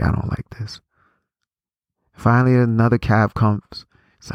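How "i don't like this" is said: dismayed whisper. Retold or imitated. Stranded for hours, dismayed.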